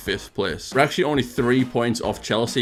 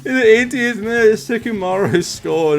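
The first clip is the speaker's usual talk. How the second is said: much higher, louder building shout; winner goal call; 12 semitones higher, 7 dB louder than usual. Acted. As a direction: explode at the late winner.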